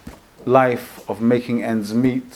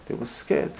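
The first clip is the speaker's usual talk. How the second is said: hushed; 12 dB quieter than usual. Acted. Hushed dramatic aside.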